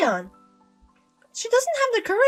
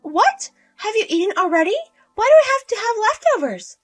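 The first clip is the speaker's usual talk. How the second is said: indignant; 11 semitones higher, 5 dB louder than usual. Acted. Mother-in-law offended by leftovers.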